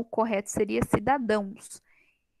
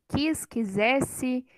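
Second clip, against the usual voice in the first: "Quis, quisesse" read out slowly, word by word; slow teaching read; much slower than usual. Shown as a demonstration. Teacher spelling the verb for students, slow and clear. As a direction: draw out "Quis, quisesse" so the class hears the S. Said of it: "ele é todo com S".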